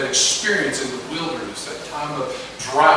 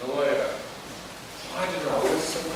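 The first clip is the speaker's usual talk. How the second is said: softer, dropped repeat; quiet echo; 9 dB quieter than usual. Acted. Preacher echoing the trapped feeling, softer and hushed. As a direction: let it fall away to nothing.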